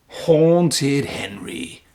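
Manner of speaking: slow, drawn-out spooky title; title read. Acted. Host opening the story for children, slow spooky title. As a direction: announce it like a creepy title card. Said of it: "Here we go".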